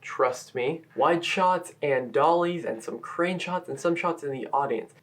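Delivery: usual speaking voice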